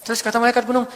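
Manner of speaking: ordinary talk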